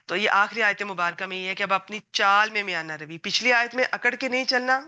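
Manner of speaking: ordinary talk, nothing marked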